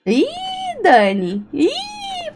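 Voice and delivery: high squeak of dismay; shocked squeal. Real, not acted. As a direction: yelp in surprise as the game is lost. Lost to the bot, squeal. (gritinho agudo de susto)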